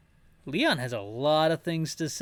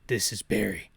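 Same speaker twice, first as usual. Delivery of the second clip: much lower Barry voice; lowered voice announcing Barry; Barry's voice; 7 semitones lower than usual. Shown as a demonstration. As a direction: drop down into Barry. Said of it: "that's Barry's voice".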